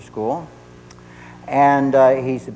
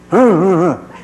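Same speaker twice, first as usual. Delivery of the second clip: loud mock growl, pitched up; imitated stinger; 5 semitones higher, 7 dB louder than usual. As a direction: growl out a wordless jab at a parent. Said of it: "stinger at me. It was sort of".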